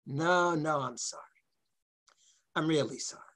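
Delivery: sheepish apology. Real, not acted. Retracting after outburst.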